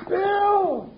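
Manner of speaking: high urgent calling out; alarmed call. Acted. Jingles calls Bill after finding the cell empty, high and alarmed. Finds the prisoner escaped.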